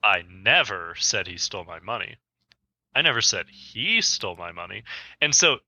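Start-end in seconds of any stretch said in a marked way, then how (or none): 0.0-2.2 "never" said as the strongest word; stress on NEVER. Shown as a demonstration. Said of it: "For example". 2.9-4.8 "he" punched, with a rise at the end; stress on HE. Shown as a demonstration. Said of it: "I did change the inflection".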